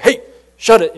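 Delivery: loud, high playful shout; mock shout. Older coworkers jokingly silencing Stasik with a loud mock shout. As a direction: bark it like a know-it-all boss. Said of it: "we'd pretend like we knew everything and be like".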